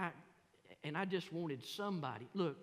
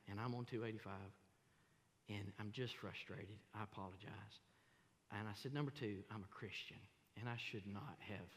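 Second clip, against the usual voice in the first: humble apology; 7 semitones lower, 5 dB quieter than usual. Retold or imitated.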